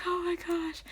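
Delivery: high, soft squeal; swooning fan reaction. Retold or imitated. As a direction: re-enact being overwhelmed by sweetness. Retells her swooning reaction.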